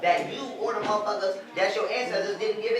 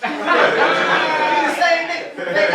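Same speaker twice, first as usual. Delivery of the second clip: loud, high, through laughter; laughing hard; 10 semitones higher, 10 dB louder, much slower than usual. Real, not acted. Telling listeners the name change is a fake ID, laughing loudly. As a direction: crack up while landing the punchline. Cracking up at own punchline.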